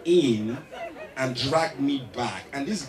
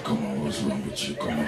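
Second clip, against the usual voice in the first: much lower, softer, thick come-on; thick seductive voice; 12 semitones lower, 5 dB quieter, faster than usual. Retold or imitated. Matthew luring the comedian in bed, low and thick. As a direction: pull him in with a deep murmur. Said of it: "their voice gets thicker".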